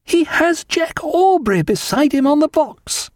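very high, drawn-out exclamation; excited cry. A narrator's reading. Wife seeing Jack on the coach, high excited cry. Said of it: "she cried".

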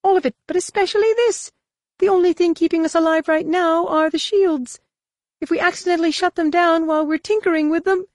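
very high, quick alien voice; Droma's retort. Acted. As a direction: snap back in a squeaky alien voice.

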